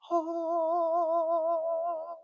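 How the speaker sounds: high, loud gasp of wonder; awed oh. Retold or imitated. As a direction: let out a stunned, high oh of awe. Awed walking into gun shops.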